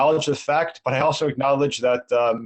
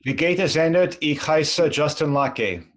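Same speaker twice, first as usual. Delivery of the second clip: spoken German; 2 semitones higher than usual. Shown as a demonstration. Guest shows off his German when the host asks. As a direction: introduce yourself in easy German. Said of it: "say Hi, my name is Justin Lakay in six different languages".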